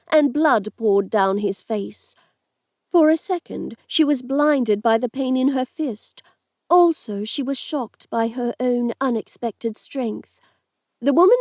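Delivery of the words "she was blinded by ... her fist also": ordinary delivery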